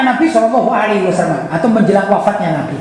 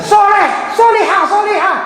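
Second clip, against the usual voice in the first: calling out; 8 semitones higher than usual. Acted. Preacher calling out pious wife.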